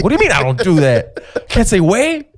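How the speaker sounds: high-pitched mock disbelief; indignant protest. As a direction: protest as if wronged, voice climbing. Acts out protest at being corrected.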